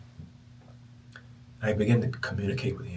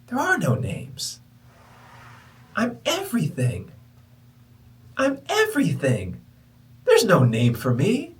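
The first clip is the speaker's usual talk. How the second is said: high, slow, spacious otherworldly voice; voice of the universe; 7 semitones higher, 6 dB louder, slower than usual. Retold or imitated. The universe answers him, slow high ethereal voice. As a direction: speak slowly as the vast universe.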